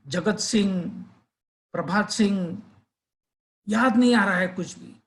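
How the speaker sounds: halting guesses at a forgotten name; trying to remember. A narrator's reading. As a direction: grope for the name, trying one after another. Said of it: "वो याद करने की कोशिश करता है". (याद करने की कोशिश में अटकते हुए)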